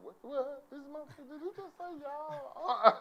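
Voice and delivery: high-pitched mock disbelief, stretched out; outraged crowd. One side of the room reacting to y'all, outraged. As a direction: squeal it like a scandalized audience. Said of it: "this side of the room went".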